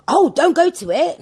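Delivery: imitating nosy woman. Nosy woman voiced in story.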